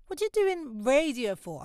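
a hint of grandma questioning the career; disapproving grandma. Grandma asking granddaughter about radio, lightly imitated disapproval. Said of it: "my grandma being like".

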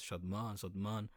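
"Shadman" with long open "ah" vowels, "Shahd-mahn"; "Shadman" the family way, back "a" in both syllables; harder original pronunciation. Shown as a demonstration. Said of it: "Most people can pronounce it, whereas".

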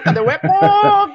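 high, loud, laughing call; playful laughing call. Shown as a demonstration. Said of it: "Vamos ver se dá eco".

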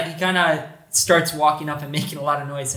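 ordinary delivery

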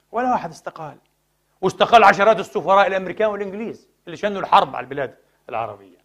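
ordinary talk, nothing marked

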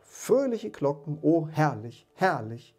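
high, loud, drawn-out joy easing off; rapturous delight. A narrator's reading. Scrooge at the window hearing merry bells, rapturous. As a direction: revel in each word.

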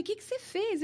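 high-pitched startled question; retold alarm, pitched high. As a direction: ask it in shock, voice jumping up. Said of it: "E eu falei".

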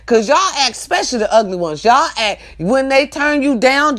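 ordinary talk, nothing marked

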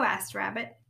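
ordinary delivery

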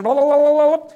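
loud, high babbled filler; rattled-off gibberish. Retold or imitated. Imitating a walking Bible quoting Revelation, loud babble. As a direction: babble like reeling off verses. Mimics man rattling off scripture.

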